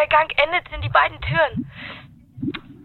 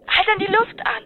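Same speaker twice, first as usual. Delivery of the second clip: usual speaking voice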